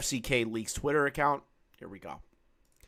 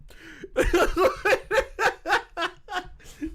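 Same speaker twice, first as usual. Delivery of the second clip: high burst of real laughter; loud laughter; 4 dB louder than usual. Real, not acted. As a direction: crack up loudly. Laughing at the clip.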